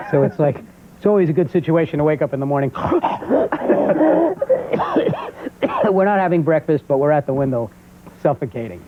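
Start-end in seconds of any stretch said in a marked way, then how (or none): 2.8-5.9 high-pitched mock choking fit; choking gasp. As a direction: act out waking up suffocating. Waking up in overheated house.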